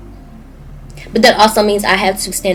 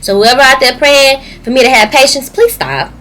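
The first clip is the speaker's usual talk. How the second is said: louder, pointed call to stop; emphatic plea; 7 semitones higher, 7 dB louder than usual. Real, not acted. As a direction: tell them firmly to quit it. Scolding people praying for patience.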